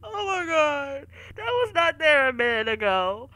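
loud, panicked after the fright; shaken. Real, not acted. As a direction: still reeling from the scare. Right after jump scare.

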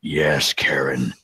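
low, slow little devil voice; shoulder devil voice. Acted. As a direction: tempt her low and slow. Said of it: "And the mini devil Karen on my shou-, other shoulder says".

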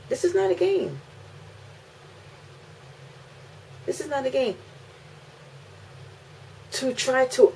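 high-pitched, emphatic outrage; real, rising indignation; indignant protest. Real, not acted. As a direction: protest fiercely as a protective mother. Mother indignant over son's danger.

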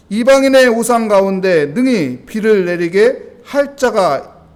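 slow, loud, stretched verse recitation; solemn scripture reading. Acted. Preacher reads verse twenty-two aloud, slow and loud. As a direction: declaim the verse with weight.

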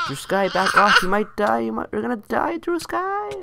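high-pitched alarm, rising as it goes; worked-up, climbing plea to retreat; urgent warning. Real, not acted. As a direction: warn a teammate off in rising panic. Urgently warning teammate of danger.

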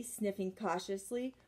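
usual speaking voice

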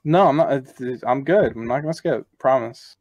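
normal talking voice

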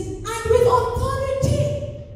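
very high, loud, drawn-out exclamation; amazed exclamation. Retold or imitated. Impressed crowd remarks on Jesus's teaching, amazed. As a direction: stretch it out slowly in ringing amazement. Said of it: "They were all amazed".

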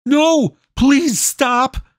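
high, pleading cry; a boy crying out as his friend is attacked; desperate cry. A narrator's reading. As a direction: beg her to stop. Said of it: "cried Lucas".

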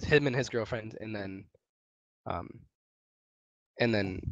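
normal talking voice

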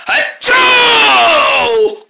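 a huge high sneeze that bursts out; loud sneeze. Acted. Waking up, a character lets out a loud sneeze.

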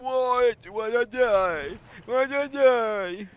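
very high, loud drawn-out wail; stretched-out, piercing mock lament; mock complaint. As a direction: whine it loudly in a squealing falsetto. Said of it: "that's how it sounds".

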